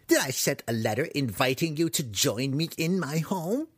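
high, ranting hermit; crazed wizard, indignant. Acted. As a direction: rattle off sarcastic questions in outrage.

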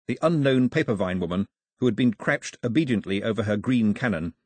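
usual speaking voice

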